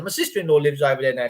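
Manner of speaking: ordinary talk, nothing marked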